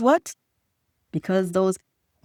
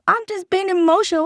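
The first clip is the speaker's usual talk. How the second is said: mocking the excuse, higher and quick; lighter mock excuse; 8 semitones higher, faster than usual. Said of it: "That's lie number five".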